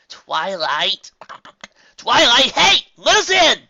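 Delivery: calling out. A narrator's reading. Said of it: "Prima Dazz's voice said".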